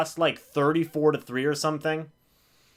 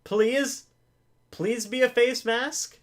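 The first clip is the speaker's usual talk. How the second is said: higher, hopeful begging; hopeful pleading; 7 semitones higher, slower than usual. Real, not acted. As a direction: beg for the flag to be a face mask. Hoping for a penalty.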